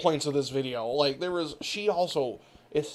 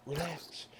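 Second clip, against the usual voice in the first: soft relax; 3 semitones lower, 11 dB quieter than usual. Retold or imitated. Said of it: "the next fucking word she would say was".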